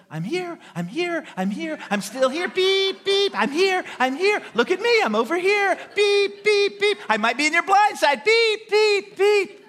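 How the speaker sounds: be a horn that won't stop announcing itself; chattering horn voice. Retold or imitated. Preacher voicing a Honduran horn saying I'm here, in a mock chatter. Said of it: "it goes".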